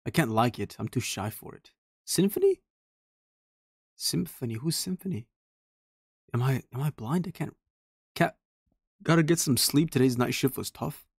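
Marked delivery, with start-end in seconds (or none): 2.1-5.3 high-pitched, rising question; puzzled query. Real, not acted. Player reading posts stumbles on an unknown name, puzzled query. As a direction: squeak the name upward in puzzlement.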